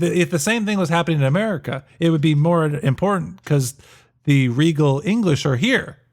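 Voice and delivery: usual speaking voice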